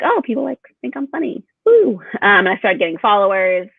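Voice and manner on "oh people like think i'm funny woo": delighted. Retold or imitated. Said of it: "And I was like".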